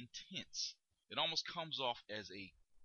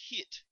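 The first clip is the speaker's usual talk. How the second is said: "hit" jumps much higher, hit hard; punched word; 11 semitones higher, 4 dB louder than usual. Shown as a demonstration. Coach showing impact, "hit" punched high. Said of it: "or impress upon".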